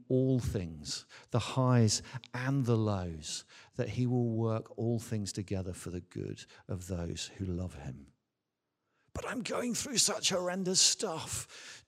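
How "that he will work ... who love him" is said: normal talking voice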